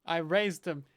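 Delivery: mock heartfelt. Mock sentimental over a mob.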